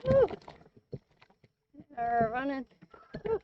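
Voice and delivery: talking while bouncing along on rough ground; on a bumpy road. Real, not acted. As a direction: voice jolted by a bumpy ride. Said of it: "Sorry for the bumpy ride".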